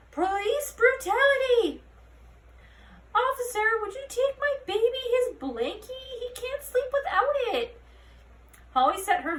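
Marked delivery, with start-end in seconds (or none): 0.1-1.8 high, loud, slow outraged yell; shouted protest. A narrator's reading. A protester yelling at Holly outside the station. 3.2-7.8 high, pleading mother's voice; pleading parent. A narrator's reading. A goblin mother begging Holly to deliver a blankie.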